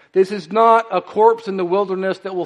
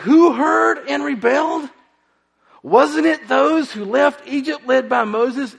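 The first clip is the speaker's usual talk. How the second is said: much higher incredulous shout; mock the absurdity of rebelling; 9 semitones higher than usual. Mocking the asinine rebellion.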